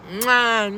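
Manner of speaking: loud smacking kiss sound into the mic; blown kiss. Real, not acted. Vlogger signing off to her viewers with a loud blown kiss. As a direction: send a big kiss to the camera.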